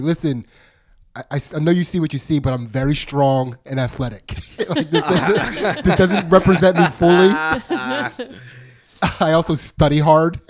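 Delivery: high, laughing defensive pitch; earnest laughing excuse. Retold or imitated. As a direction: plead your case while cracking up.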